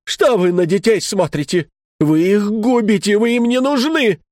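put-on wife shouting at husband over children. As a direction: accuse him, voice climbing. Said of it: "А жена кричит".

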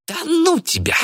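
very high, squeaky brush-off; dismissive. A narrator's reading. Old man brushes off his accuser, squealing dismissively. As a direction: wave it away with a high squeal. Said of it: "отмахнулся Кайратов".

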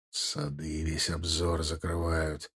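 low, slow and very soft; low answer. A narrator's reading. Soldier reporting blocked view ahead, low and quiet. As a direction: answer under your voice, slowly.

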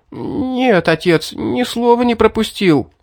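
put-on high, teeth-chattering boy. A narrator's reading. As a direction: stammer through chattering teeth. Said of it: "клацая зубами".

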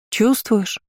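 high-pitched insistent question, falling at end; demanding. A narrator's reading. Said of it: "обращаясь более требовательно". (требовательно, высоким тоном, с падением)

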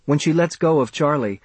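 ordinary delivery, nothing marked

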